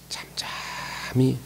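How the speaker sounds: drawn-out hushed whisper; slow whisper. Acted. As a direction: breathe the word out slowly. Preacher urges quiet submission.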